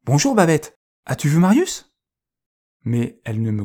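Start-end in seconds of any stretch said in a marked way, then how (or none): 0.0-1.9 much higher and louder, full of hope; bright hopeful dog's call. A narrator's reading. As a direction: call out eagerly, hoping for good news. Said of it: "plein d'espoir".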